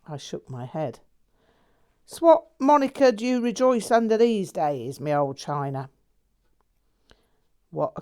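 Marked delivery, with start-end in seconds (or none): 2.0-5.9 louder, higher cheeky mate; a breezy lad spinning his tale; lightly voiced cheeky Londoner. A narrator's reading. Jack teasing his old friend, in a cheery Londoner voice. Jack's voice.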